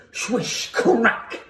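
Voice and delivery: hissed swish, then sharp high crack; cane swish and crack. A narrator's reading. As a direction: voice the cane whistling down and striking. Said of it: "went the cane".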